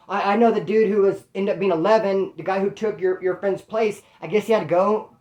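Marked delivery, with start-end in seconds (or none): none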